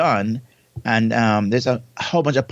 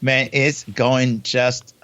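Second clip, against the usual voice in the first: slow, savoured fantabulous; playfully drawn-out reply; much slower than usual. Real, not acted. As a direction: stretch every syllable for fun.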